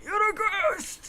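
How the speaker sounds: high, drawn-out melodramatic alarm; slow, high cry at seeing a phantom; frightened accusation. Acted. Prisoner accuses lawyer of being ghost.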